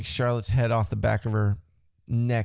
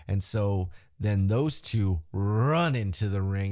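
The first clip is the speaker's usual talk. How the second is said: ordinary delivery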